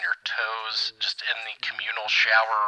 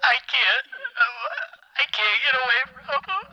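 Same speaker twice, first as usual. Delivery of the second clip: high-pitched, tearful wail; real crying, voice pushed way up; losing it completely through tears; full-on breakdown; faster than usual. Real, not acted. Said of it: "He had a full-on breakdown".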